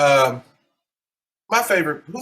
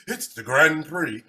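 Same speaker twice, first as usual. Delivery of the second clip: ordinary delivery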